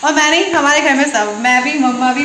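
normal talking voice

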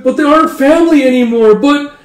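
high, loud mock wail; tearful protest. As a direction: whine it like an upset girl. Tearful brides protest losing family.

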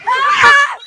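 loud high play scream; pretend scream. Fleeing the pretend skeleton, loud high scream. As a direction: shriek at the skeleton.

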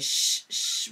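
urgent whispered shush; whispered shh. Acted. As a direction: hush them fast. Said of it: "put his finger up to his mouth".

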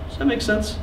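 usual speaking voice

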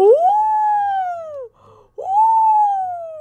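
long high loud shriek; startled scream. Real, not acted. Player fleeing a chaser in a game, startled scream.

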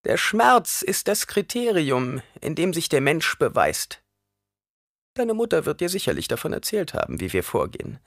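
high crone voice, emphatic; old woman pronouncing judgement, pitched high; instructing old woman. A narrator's reading. Reverend mother tells the boy pain proves the human, high voice.

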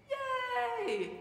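very high, drawn-out yay; demonstrated cheer. Shown as a demonstration. Pastor practises the reaction to liked things, gleeful cheer. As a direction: model a playful cheer for the kids. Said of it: "that you like, you, we say".

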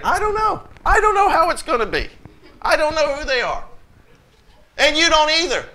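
high-pitched, emphatic disclaimer; exasperated insistence. Real, not acted. Emphatic repeated disclaimer to congregation.

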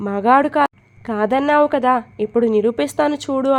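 ordinary delivery

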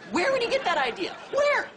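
high-pitched, increasingly loud exasperation; exasperated outburst. Acted. Ranting about a brother's silly nickname idea, exasperated.

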